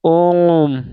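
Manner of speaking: ओम held long and low; drawn-out Om. Shown as a demonstration. Teacher showing a long vowel, drawn-out ओम. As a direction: stretch ओम like a chant. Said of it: "जैसे".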